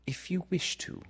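put-on high, soft girl's voice; demure Wendy. A narrator's reading. Wendy agreeing to Peter's thimble, high and demure. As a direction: consent primly in a small high voice.

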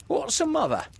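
very high, ignorant pirate voice; Smee. A narrator's reading. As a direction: ask cluelessly in a high squeak. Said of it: "asked the ignorant Smee".

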